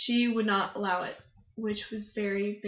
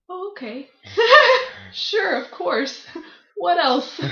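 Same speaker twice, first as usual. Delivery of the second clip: higher, slow, laughing "sure, of course"; laughing mock acceptance; 8 semitones higher, 14 dB louder, slower than usual. To the witch who let them in, laughing mock agreement. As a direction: play along through laughter, disbelieving. Mocking reply to witch's claim.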